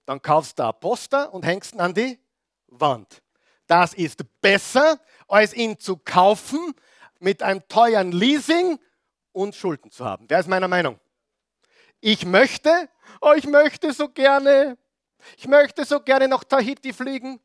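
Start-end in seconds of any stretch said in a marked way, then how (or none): 3.7-10.2 forceful preaching. Acted. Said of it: "preaching at full force". 12.0-17.4 dreamy longing. Retold or imitated. Preacher acting out a dreamy wish to fly to Tahiti, swooning.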